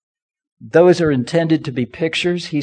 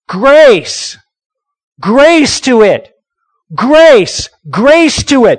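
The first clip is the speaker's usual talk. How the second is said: higher, louder preacher's shout of Grace; 7 semitones higher, 7 dB louder, much slower than usual. Retold or imitated. Preacher shouting the verse's call of grace to the church. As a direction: cry it out over the stones.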